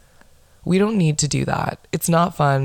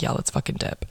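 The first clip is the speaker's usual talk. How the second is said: retold in a near-whisper; hushed aside; 8 dB quieter than usual. Friends agreeing to leave after the cop's order, hushed. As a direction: whisper it like a secret plan to slip off.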